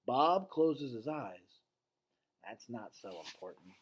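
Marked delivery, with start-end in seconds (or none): 2.4-3.7 said quietly and much softer, lower; a soft, low, withdrawn murmur; hushed and subdued, almost to himself. Acted. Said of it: "he says quietly".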